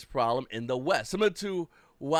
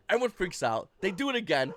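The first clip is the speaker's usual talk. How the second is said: ordinary talk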